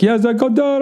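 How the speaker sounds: high, mock foreign driver voice; driver's voice. Retelling the car service driver on the phone, high mock voice. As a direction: squeak out the driver's broken reply.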